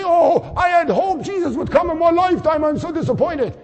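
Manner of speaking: high, loud hammed-up lament; mock disappointment. Imagined sleeper shouting his disappointment, high. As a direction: wail it like a let-down believer. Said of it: "He's not gonna say".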